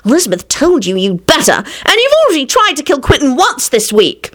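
mildly exasperated scolding, rising high; irritable. A narrator's reading. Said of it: "she added irritably".

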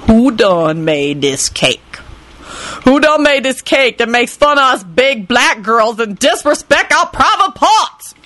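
slow, rising indignant tirade; furious sassy woman. A narrator's reading. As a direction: build up to outrage. Lucretia furious about cake.

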